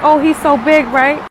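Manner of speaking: high, loud retold kid exclamation; awed child. Son marvels at ceiling figure, loud awe. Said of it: "Then he like".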